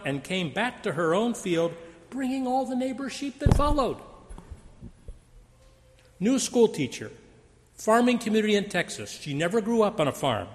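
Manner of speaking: usual speaking voice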